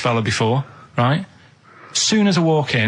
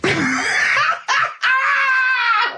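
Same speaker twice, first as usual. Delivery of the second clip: a shrieking burst of real laughter. Real, not acted. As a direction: crack up at the wig punchline. Laughs at wig story.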